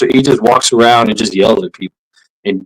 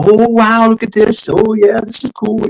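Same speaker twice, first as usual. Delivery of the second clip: high-pitched imitation of awed visitors; wowed tourists; 10 semitones higher than usual. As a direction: coo at the sights, pitched way up. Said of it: "Just taking it in".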